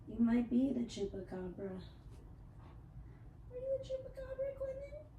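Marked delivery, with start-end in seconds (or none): 3.5-5.1 much higher, fast cooing pet voice. Real, not acted. Baby talk to cat.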